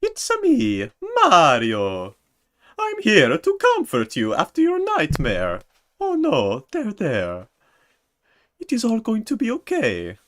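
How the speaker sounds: very high, loud cartoon Mario voice comforting the listener; squeaky Italian plumber soothing you after a nightmare; over-the-top high Mario ASMR; Mario impression. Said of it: "like a Mario A S M R".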